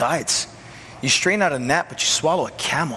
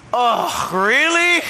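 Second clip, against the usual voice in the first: higher, louder incredulous "oh, really?"; mock surprise; 9 semitones higher, 4 dB louder, much slower than usual. Asking Jesus back in disbelief, mock surprised. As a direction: play it as a sarcastic double take.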